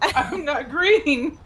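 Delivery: laughing protest. Acted.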